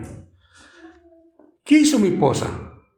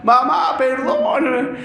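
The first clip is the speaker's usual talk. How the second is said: crying plea; 6 semitones higher, slower than usual. Retold or imitated. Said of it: "y lloraba de una forma".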